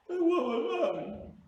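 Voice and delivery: high, loud, drawn-out wail; mock crying child. Crying boy at his father's house, hammed-up wail for his mother. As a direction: wail slowly like a bawling little kid. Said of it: "the kid starts crying".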